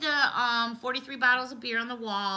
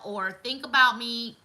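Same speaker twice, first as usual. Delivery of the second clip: ordinary talk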